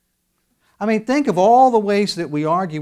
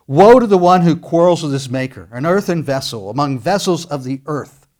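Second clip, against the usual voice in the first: loud, ringing scripture read; preaching scripture; 9 dB louder than usual. Acted. Preacher reading the verse to his congregation, proclaiming loudly.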